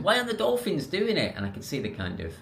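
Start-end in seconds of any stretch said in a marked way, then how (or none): 0.0-1.3 high, whiny mock American accent. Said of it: "apologies for the accent".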